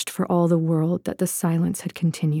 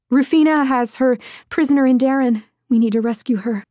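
higher, quick, pressing plea; urgent blurting; 5 semitones higher, 3 dB louder, faster than usual. A narrator's reading. Woman telling her lover of a prisoner needing rescue, urgent. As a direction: rush the news out urgently.

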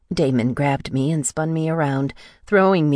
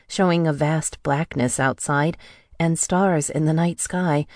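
plain reading voice

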